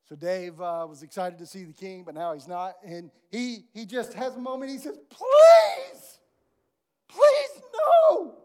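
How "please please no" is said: crying out very high and loud; wailing please, begging for his life; desperate cry. Acted. Dave begs the king not to sell his family, crying out.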